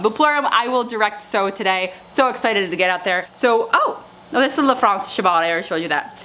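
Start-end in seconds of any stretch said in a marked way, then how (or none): none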